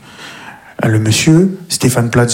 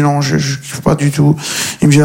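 ordinary talk, nothing marked

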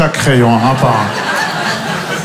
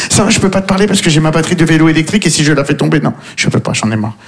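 mock fussy bobo; 3 semitones higher than usual. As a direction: rattle off the excuse, then trail away. Mock bobo with e-bike battery.

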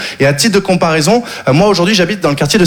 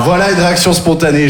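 usual speaking voice

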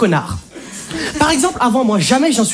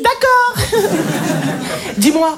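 higher, sweet, giggly submission; meek boyfriend; 6 semitones higher than usual. Acted. A man on the phone to his girlfriend, giggling and meek. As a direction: coo like a tamed boyfriend. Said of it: "Je vais vous montrer".

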